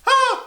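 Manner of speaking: short, very high loud shriek; sudden tiny-animal screech; rabbit scream. Acted. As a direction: squeal like a frightened rabbit. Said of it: "The rabbits went".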